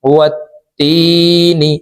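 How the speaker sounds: ওয়াত্তিনি slow, the তি stretched one alif. Shown as a demonstration. Teacher showing the stretched তি to students. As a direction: draw out তি in ওয়াত্তিনি. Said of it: "এক আলিফ টেনে পড়ব".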